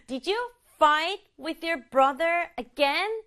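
"fight", "brother" and "again" stressed, slow question; slow rhythmic question leaning on "fight", "brother", "again"; stress on key words. Shown as a demonstration. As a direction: punch "fight", "brother" and "again", rising at the end. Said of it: "어디 강조하면 좋을 것 같아요".

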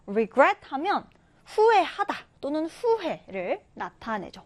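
ordinary talk

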